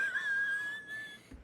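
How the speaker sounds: shrill delighted squeal of laughter; high squeal laugh. Real, not acted. Streamer cracks up at chat, squeal laugh. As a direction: let a squeaky laugh burst out.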